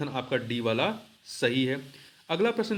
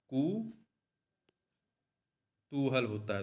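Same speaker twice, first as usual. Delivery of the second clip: कुतूहल said very slowly and softer, each syllable stretched; slow, soft dictation; 5 dB quieter, much slower than usual. Shown as a demonstration. Teacher dictates the correct form कुतूहल to students, slow and soft. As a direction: draw out कुतूहल gently so students can write it.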